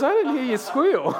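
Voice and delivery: breaking into laughter mid-quote; laughing. Real, not acted. Pastor quoting friend while cracking up, laughing.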